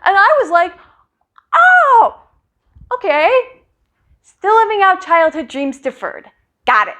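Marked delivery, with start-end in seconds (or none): 1.5-6.9 sarcastic. Retold or imitated. Sarcastic reply to dad.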